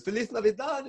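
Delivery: high and loud, beaming holiday wish; joyful greeting. Retold or imitated. The mom hugs the elf, high joyful Spanish greeting.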